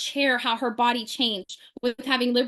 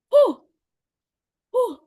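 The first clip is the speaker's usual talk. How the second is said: nervous exhale; 11 semitones higher, 6 dB louder than usual. Retold or imitated. Nervous blowing out.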